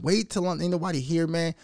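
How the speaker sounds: normal talking voice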